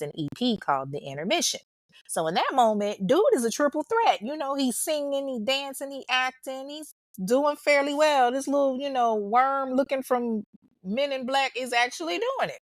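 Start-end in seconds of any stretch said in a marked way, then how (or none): 3.1-12.6 high mock-amazed voice; mocking disbelief. Real, not acted. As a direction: sell it like you can't believe this guy made it. Mocking his rising success.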